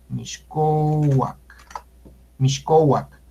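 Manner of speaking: Mixcoac said "mish-KO-wak", x as sh; correct Nahuatl pronunciation. Shown as a demonstration. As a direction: model "Mixcoac" with sh in place of s. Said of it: "se debe de pronunciar".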